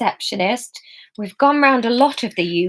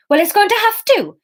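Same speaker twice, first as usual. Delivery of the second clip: insistent; 12 semitones higher, 5 dB louder than usual. A narrator's reading. Fiona threatening insistence.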